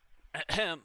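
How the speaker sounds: pointed, exaggerated ahem; loud throat clearing. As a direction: clear the throat to get attention.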